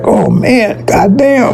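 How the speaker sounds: lightly voiced Abraham throwing up his hands; exasperated Abraham. Preacher retelling Abraham's reaction, mock exasperated. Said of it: "he was like".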